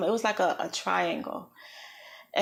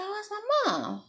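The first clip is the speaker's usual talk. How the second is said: high, soft, frightened call for mom; a dazed crash victim softly calls out for her mom; panicked question; 13 semitones higher, 4 dB quieter than usual. Retold or imitated. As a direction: ask it small and scared, voice lifted high. Searching for family after the crash.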